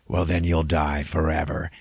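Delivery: rough monster threat; menacing demon voice; Dormammu. Retold or imitated. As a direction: pronounce doom as a giant demon. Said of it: "And he's like".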